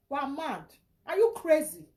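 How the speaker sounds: normal talking voice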